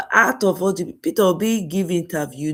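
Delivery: ordinary delivery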